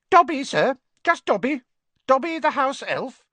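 high, loud eager house elf; high-pitched creature. A narrator's reading. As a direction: introduce yourself in a squeaky elf voice. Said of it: "in a high-pitched voice".

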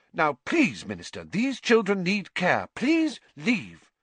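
high, insistent demand; firm nurse. A narrator's reading. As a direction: shoo them out.

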